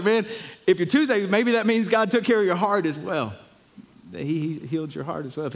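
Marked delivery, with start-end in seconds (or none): 0.0-3.3 rising, louder excitement as he retells it; excited. Retold or imitated. Pastor excitedly telling Daniel his heart is healed. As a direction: tell him the good news with a burst of joy.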